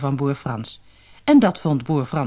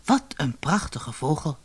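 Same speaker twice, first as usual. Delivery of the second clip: thinking aloud; 6 dB quieter than usual. Acted. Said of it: "dacht Frans".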